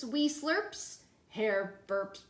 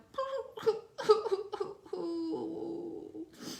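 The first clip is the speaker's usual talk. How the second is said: mock blubbering; 5 semitones higher, 3 dB quieter than usual. Bear blubbers on.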